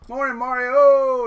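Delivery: a big, high, loud call of a name; loud greeting. Real, not acted. Calling out good morning to viewer Mario, loud and high. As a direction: hail a viewer across the room.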